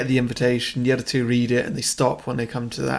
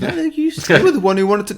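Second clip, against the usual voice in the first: squeaky high accusing protest; 6 semitones higher, 4 dB louder than usual. Retold or imitated. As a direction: push back in a high voice. Acting the other side arguing.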